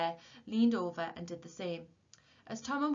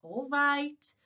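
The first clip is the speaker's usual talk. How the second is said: high, loud shout over the shoulder; shouted back; 9 semitones higher, 9 dB louder than usual. A narrator's reading. Tom to the warden behind him, shouted back. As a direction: yell it back to shut him up. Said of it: "shouted back Tom over his shoulder".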